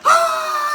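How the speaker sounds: high loud falling scream; man falling from plane hollers; hollering. Retold or imitated. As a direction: let out a long wild holler. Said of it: "he starts hollering".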